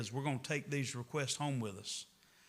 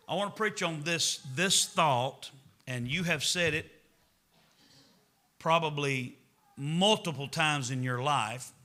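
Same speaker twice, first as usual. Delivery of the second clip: usual speaking voice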